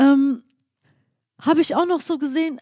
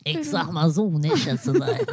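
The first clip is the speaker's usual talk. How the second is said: low, put-on Uwe voice, much deeper; 8 semitones lower, 4 dB quieter than usual. Cohost pulls out the Uwe voice, deep put-on man. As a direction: growl it out low as the Uwe character. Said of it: "bitte nicht diesen Uwe rausholen".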